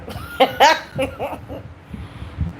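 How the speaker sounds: long amused laugh at oneself; laughing off a bad impression. Real, not acted. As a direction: break into giggles. Laughing at own fake accent.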